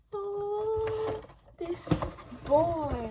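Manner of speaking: high, loud, emotional outburst; gushing, moved by the flowers; overwhelmed gush. Real, not acted. Touched by surprise flowers from boyfriend.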